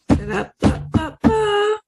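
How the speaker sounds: high sung drumroll, slowly; mock drumroll. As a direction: hum a comic drumroll before the punchline. Drumroll before joke punchline.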